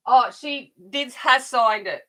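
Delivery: loud, high burst of news; delighted and loud; excited. Real, not acted. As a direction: blurt out the good news. Excited news, signed the file.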